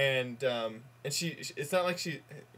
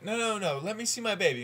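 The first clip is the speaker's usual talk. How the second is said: mildly urgent mother, pitched higher; higher-pitched, lightly acted demand; acted insistence; 6 semitones higher, faster than usual. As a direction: play an impatient mother lightly. Mother cuts off doctor in joke.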